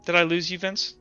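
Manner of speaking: ordinary talk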